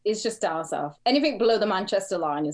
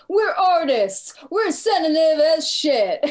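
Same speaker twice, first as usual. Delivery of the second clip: loud, high hammed-up rock star boast; mock rocker pride; 9 semitones higher, 6 dB louder, slower than usual. [name] mocks sensitive rockers in a big voice. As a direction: ham up a proud rock star.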